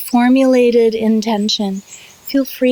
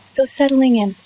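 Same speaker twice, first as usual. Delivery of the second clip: guided meditation. Real, not acted. Yoga teacher leading students through settling and self-massage, guided meditation. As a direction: draw out key words, long pauses between short phrases. Leads guided relaxation practice.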